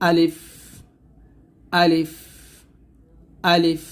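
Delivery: short আলিফ, breath let out on the final ফ; আলিফ উচ্চারণ. Shown as a demonstration. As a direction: say আলিফ quickly, no drawn-out vowel, airy ফ at the end. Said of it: "আলিফটা উচ্চারণ করতে হবে এরকম". (আলিফ তাড়াতাড়ি, শেষে বাতাস বের করে)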